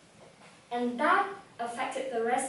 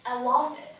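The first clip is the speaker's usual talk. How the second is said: ordinary talk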